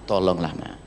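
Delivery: soft plea. Pretend. Husband softly pleading with his wife to let him be angry. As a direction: beg her gently.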